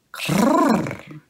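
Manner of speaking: high rolled cat cry; cat's trill. Acted. The cat running to her milk, a high trill. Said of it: "she cried".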